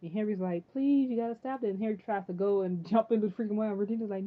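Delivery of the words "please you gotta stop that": higher, louder mock plea; pleading boy. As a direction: imitate a kid begging urgently. Acting Henry pleading.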